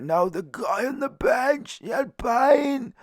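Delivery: very high, slow put-on wail; woman's wailing voice. Woman blaming the guy on the bench, high mock wail. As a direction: imitate her, stretching the words up high.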